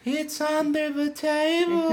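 put-on high, slow creepy child voice; whisper-sing it like a ghost child; eerie little horror-movie kid. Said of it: "like, kids being like".

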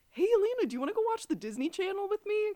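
put-on high, loud, fast, eager kid; chirpy kid rushing the question; little boy voice. Little brother eagerly asking his sister to watch TV.

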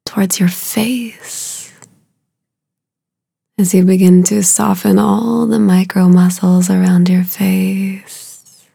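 ordinary delivery

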